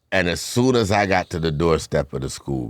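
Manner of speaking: ordinary talk, nothing marked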